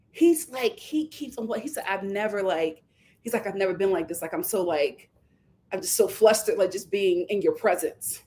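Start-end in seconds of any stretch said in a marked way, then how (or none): 1.9-8.2 low, soft, halting mock shyness; imitated bashful flustering. Nick flustered in her presence, imitated bashful flustering. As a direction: stumble softly like a smitten suitor. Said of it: "Nick is just blushing".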